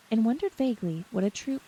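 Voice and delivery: plain reading voice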